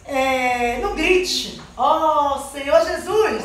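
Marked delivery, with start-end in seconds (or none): none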